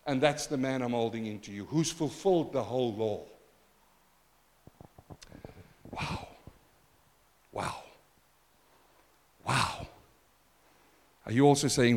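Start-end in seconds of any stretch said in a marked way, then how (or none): 0.1-9.9 hushed awe. Real, not acted. Hushed awe after preaching.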